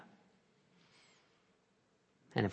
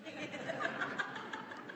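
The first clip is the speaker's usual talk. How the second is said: short high breathy laugh; wry laugh; 14 dB quieter than usual. Real, not acted. Preacher laughing at his own irony, wry laugh. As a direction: laugh it off wryly.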